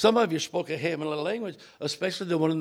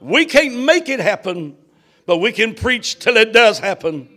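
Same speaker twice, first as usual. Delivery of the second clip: loud, higher, building fervent prayer; full-force preaching prayer; 5 semitones higher, 16 dB louder, slower than usual. Real, not acted. Preacher praying over tonight's service, fervent and very loud. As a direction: cry out the prayer with rising force.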